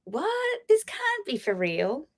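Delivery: squeaky retold astonishment; stunned disbelief. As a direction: replay the moment of shock. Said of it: "And I'm like".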